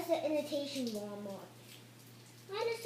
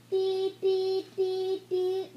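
robotic beeps; 5 semitones higher, 5 dB louder, much slower than usual. Pretend. Robot character malfunctioning.

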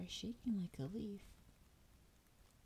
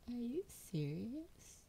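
ordinary talk

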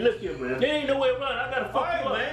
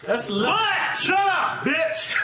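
loud angry shout; 6 semitones higher, 5 dB louder, much slower than usual. Real, not acted. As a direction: yell them down. Shouts to cut off the other.

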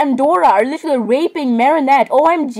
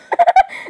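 high laugh. Real, not acted. Laughing at her own remark.

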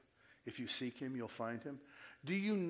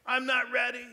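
high, loud mock panic; mock panicked wail; 11 semitones higher, 10 dB louder, slower than usual. Youth group freaking out over the coming, panicked wail. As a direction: cry it out like a panicked teen. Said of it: "crying, wailing".

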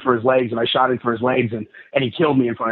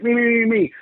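eager volunteering; 11 semitones higher than usual. Retold or imitated. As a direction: jump at the chance like a kid raising a hand.

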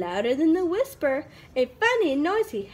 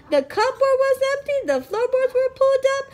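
fast, high list; 10 semitones higher, 3 dB louder, faster than usual. A narrator's reading. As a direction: rattle it off quickly and high. Listing the destruction excitedly.

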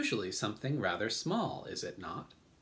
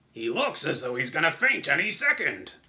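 loud, raised centipede voice; centipede character; 4 semitones higher, 6 dB louder than usual. A narrator's reading. Centipede remarks on frightened James, loud exclamation. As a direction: blurt it loudly in a put-on voice. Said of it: "the centipede said".

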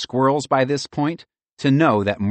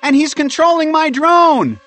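high, loud, panicked shout; high squirrel voice in alarm; 7 dB louder than usual. A narrator's reading. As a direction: holler it from up in the air. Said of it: "Pearl shouted".

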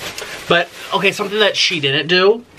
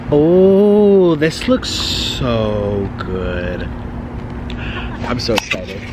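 slow awestruck admiration; awed delight; 6 semitones lower, much slower than usual. Real, not acted. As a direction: marvel at the food, drawing it out. Admiring the food.

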